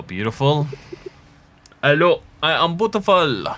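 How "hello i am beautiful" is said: hammed-up Italian accent, higher and louder; bad Italian accent. Player greets something beautiful in the game, hammed-up Italian accent. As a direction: put on a badly faked Italian accent. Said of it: "Became Italian. Can't really do an Italian accent".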